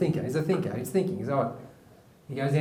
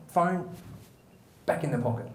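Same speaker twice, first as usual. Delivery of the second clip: ordinary talk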